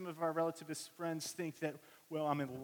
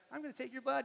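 nice phlebotomist; 10 semitones higher, faster than usual. Retold or imitated. Phlebotomist answers the frightened boy.

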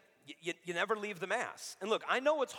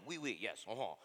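French priest murmur; 7 semitones lower, 7 dB quieter than usual. Retold or imitated. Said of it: "He's like".

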